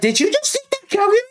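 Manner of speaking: very high, fast accusing mock voice; mocking teacher. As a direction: fire it off in a squeaky high parody of her. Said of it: "and she's like".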